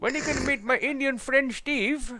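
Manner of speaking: playing a caricature Indian caller; put-on Indian accent. Prank caller voicing an Indian man, hammed-up Indian accent. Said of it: "I wanna play voices against him".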